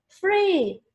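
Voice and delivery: high-pitched one-word cheer; crowd's shout. A narrator's reading. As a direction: repeat the crowd's cry, ringing out. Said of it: "shouted the people".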